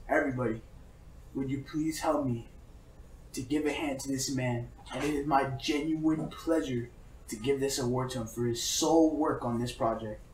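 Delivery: soft, low ceremonial presenter; speech-giving. Retold or imitated. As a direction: imitate a measured, hushed award presentation. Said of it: "he goes into a speech".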